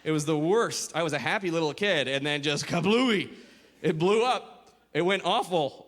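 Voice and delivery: normal talking voice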